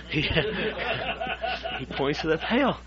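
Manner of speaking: talking through real laughter; laughing. Real, not acted. Storyteller reaching the pail joke, laughing as he talks. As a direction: crack up while telling it.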